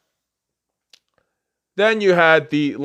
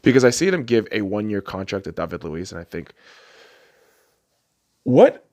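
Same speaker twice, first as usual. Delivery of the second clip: normal talking voice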